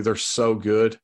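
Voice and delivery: ordinary talk, nothing marked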